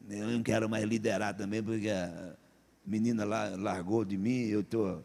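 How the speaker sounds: low, soft mock gloom; hammed-up, listless low moan; dejected mumbling. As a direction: imitate someone moping after a breakup. Acts out dejected jilted church member.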